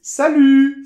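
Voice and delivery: "Salut" with a rounded French U and a silent T, high; correct salut. Shown as a demonstration. Teacher modelling salut for the class to repeat. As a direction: end on the rounded U and drop the T. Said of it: "on répète ensemble".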